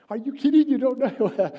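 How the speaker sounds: loud, high incredulous outburst; dad laughing at the question before a big trip, loud and incredulous; exasperated disbelief. Retold or imitated. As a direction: throw up your hands in mock disbelief. Father's exasperated reaction.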